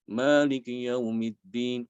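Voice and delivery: Maliki yaumiddin drawn out slowly, faint i at the end; slow recitation. Shown as a demonstration. Teacher reciting the verse as a demo. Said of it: "dibaca seperti ini".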